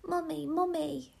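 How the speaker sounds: put-on high-pitched little girl's cry; joyful cry. A narrator's reading. Anne sees her mother on waking, crying out. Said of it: "she cried".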